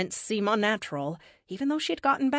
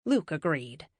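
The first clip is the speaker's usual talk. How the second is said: usual speaking voice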